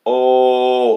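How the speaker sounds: loud, drawn-out mock wail. Mocking a friend's all-caps grief post with a long loud oh. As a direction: drag the oh out loudly. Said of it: "all caps".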